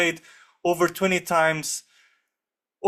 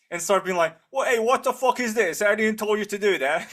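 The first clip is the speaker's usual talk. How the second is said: higher, hard cockney gangster demanding answers; aggressive cockney; 6 semitones higher than usual. Pretend. Shakespeare storming in as a cockney hard man, aggressive. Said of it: "Just really cockney hard, aggressive".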